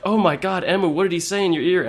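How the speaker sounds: loud, high-pitched group outcry; mock alarm. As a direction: cry out as the shocked onlookers. Group reacts aghast in retelling.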